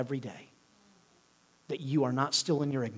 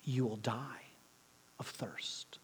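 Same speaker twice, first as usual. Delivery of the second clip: hushed lion, sinking almost to a whisper; lightly voiced lion; 3 semitones lower, 9 dB quieter than usual. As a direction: lightly play the lion, softer and lower to the end. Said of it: "the lion said to her".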